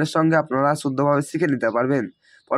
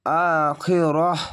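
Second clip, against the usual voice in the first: slow recitation; much slower than usual. Shown as a demonstration. Teacher to students, slow chanted recitation. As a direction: draw it out as in recitation. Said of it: "teacher demonstrates the pause form".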